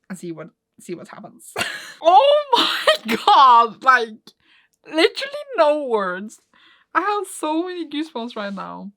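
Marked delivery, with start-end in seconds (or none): 2.0-8.9 very high, loud, drawn-out gasping disbelief; overwhelmed squeal, stretching the words; awestruck. Real, not acted. Reader lost for words at the manga, awestruck.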